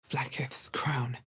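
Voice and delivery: slow, soft, hushed awe; slow awed whisper. Acted. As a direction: breathe the name of the crown in awe.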